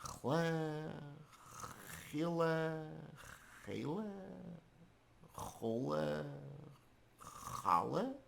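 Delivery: halting guesses, ending on a questioning rise; tentative guessing. Acted. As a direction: sound out each attempt, long pauses between. Pupil guessing an unknown word.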